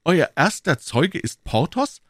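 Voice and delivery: high, slow astonished question; disbelieving query; amazed young man; surprised. Acted. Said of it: "rief D'Artagnan".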